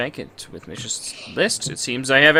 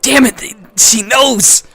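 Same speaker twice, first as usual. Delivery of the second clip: loud, high outburst of dismay; exasperated shout; 9 semitones higher, 6 dB louder, slower than usual. Real, not acted. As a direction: yell it like you've been caught. Mock outrage at being caught.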